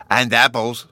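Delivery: put-on loud little-boy interjection; insistent childish addition; interjecting child Bruno. A narrator's reading. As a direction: chip in loudly and eagerly. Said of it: "Bruno put in".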